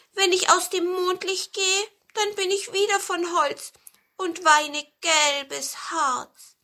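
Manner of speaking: high, wistful little lament; plaintive pine cone. A narrator's reading. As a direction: draw out the last words mournfully. Cone laments turning back to wood. (hohe, klagende Zapfenstimme)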